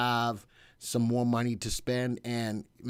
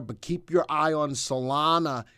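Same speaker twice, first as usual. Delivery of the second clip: normal talking voice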